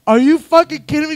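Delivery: high, loud mock outrage; acted disbelief. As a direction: retell an incredulous outburst at a partner. He reacts in disbelief.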